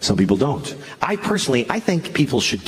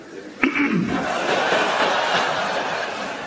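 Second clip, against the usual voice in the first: a pointed throat clear that breaks the sentence; throat clear mid-sentence; 3 dB quieter than usual. Shown as a demonstration. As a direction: clear your throat right in the middle of the line. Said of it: "don't clear your throat in the middle".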